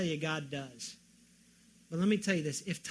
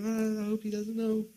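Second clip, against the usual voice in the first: cowering mock worry; 3 semitones higher than usual. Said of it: "cowering before God going".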